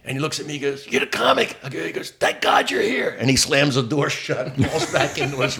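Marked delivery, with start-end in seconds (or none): 0.9-1.6 high, strained exclamation; staggering drunk greeting. Retold or imitated. Acts staggering man greeting him. 2.1-3.2 high, drawn-out cry of relief; slow, pitched-up exclamation; wasted relief. Retold or imitated. Acts staggering man's relieved cry.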